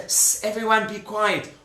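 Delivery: "S" hissed in place of shh; hushing with "s". Acted. Parent calms children down, long "S" hiss instead of shh. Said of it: "they would say s".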